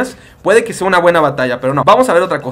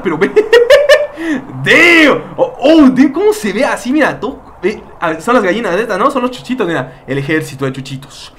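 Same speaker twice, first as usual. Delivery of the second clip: ordinary talk, nothing marked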